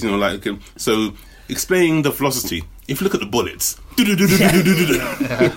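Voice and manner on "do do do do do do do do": gunfire noises. Retold or imitated. Mimicking bullets in a game.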